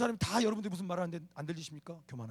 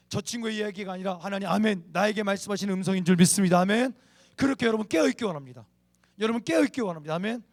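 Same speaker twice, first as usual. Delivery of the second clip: loud, raised preacher's voice; fervent preaching; 4 semitones higher, 11 dB louder than usual. Real, not acted. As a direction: press the congregation for an amen. Preaches at full force.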